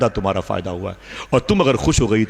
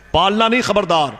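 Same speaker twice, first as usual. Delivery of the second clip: stern warning; 11 semitones higher than usual. Retold or imitated. Husband forbidding his wife to raise a girl, stern high warning. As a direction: warn her, no arguing.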